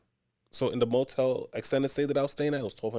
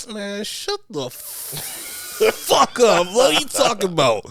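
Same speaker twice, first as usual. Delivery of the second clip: higher, louder retold outburst; mock angry snap; 9 semitones higher, 4 dB louder than usual. Telling off the annoying coworker, a loud retold snap. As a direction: fire back at her, loud and high. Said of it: "I'm like".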